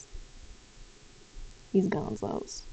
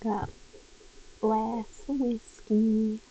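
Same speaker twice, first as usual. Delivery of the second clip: ordinary talk, nothing marked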